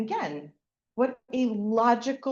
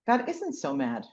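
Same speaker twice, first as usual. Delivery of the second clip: usual speaking voice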